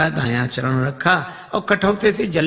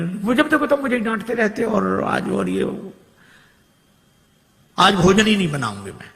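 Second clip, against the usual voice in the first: wife's put-on complaint, pitched higher; mock quarrel; 5 semitones higher than usual. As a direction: pretend to be fed up with the husband. Staged quarrel, wife scolds.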